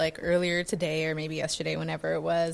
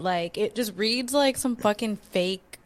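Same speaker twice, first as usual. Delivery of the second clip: ordinary delivery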